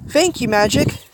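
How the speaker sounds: high-pitched playful thanks. A narrator's reading. Woman answering Tadashi by nickname, playful. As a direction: lift it high and teasing. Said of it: "the woman replied playful".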